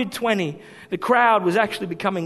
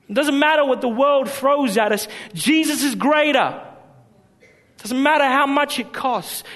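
higher and louder, soaring preacher cry; preaching at full force; 6 semitones higher, 3 dB louder than usual. Real, not acted. Preacher rallies the congregation, full-force preaching. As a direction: lift the room with rising fervour.

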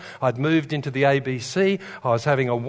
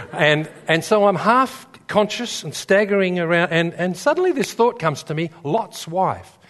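ordinary talk

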